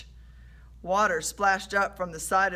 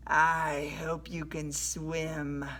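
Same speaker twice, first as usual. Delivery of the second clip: slow, softer threat; menacing sailor; 3 semitones lower, 5 dB quieter, much slower than usual. A narrator's reading. Sailor holds Rush by the collar, slow menace. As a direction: draw the threat out slowly and quietly. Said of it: "yelled Fish Breath".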